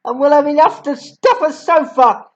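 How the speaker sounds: ordinary delivery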